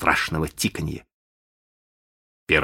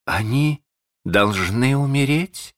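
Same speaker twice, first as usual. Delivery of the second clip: slow, raised voice rising at the end; contemptuous question; 7 semitones higher than usual. A narrator's reading. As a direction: ask it slowly and higher, with a touch of disdain. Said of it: "взглянув на него с таким бесконечным презрением". (медленный вопрос с лёгким презрением)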